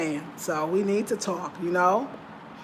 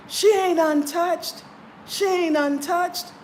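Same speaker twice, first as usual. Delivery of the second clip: high, slow, louder retort, repeated; mock jealous outburst; 10 semitones higher, 3 dB louder, much slower than usual. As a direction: imitate the jealous woman hating on her. Woman jumped up and said.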